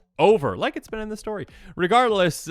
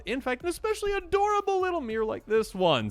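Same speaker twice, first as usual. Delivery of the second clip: cutesy coo; 13 semitones higher than usual. Acted. Cooing over a cute card.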